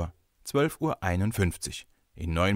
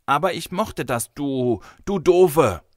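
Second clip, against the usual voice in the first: high protest building to a loud, drawn-out insult; indignant child; 6 semitones higher, 6 dB louder, slower than usual. A narrator's reading. Boy insults the girl in their quarrel, indignant. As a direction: splutter back like a cross little boy.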